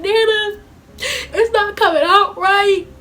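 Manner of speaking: high, slow, crying plea to Nana; tearful plea. Retold or imitated. Granddaughter crying to Nana about her hair. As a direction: whine it out like you're breaking down in tears.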